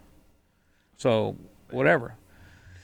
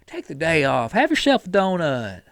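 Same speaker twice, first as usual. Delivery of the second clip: mock-sympathetic coaxing, swooping pitch; coddling enabler; 3 semitones higher than usual. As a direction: imitate a friend indulgently excusing someone. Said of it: "people that say".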